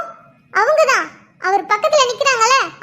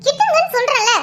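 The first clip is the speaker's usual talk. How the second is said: higher-pitched, indignant order; angry demand; 5 semitones higher than usual. Acted. Wife orders in-law to let go of husband, angry demand. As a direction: order them off, pitched up in anger.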